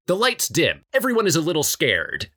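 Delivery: ordinary delivery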